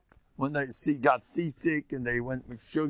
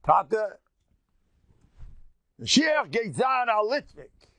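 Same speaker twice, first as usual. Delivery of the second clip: high, slow teasing daughter's voice; 10 semitones higher than usual. Retold or imitated. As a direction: tease your father in a higher voice. Wife teasing her father.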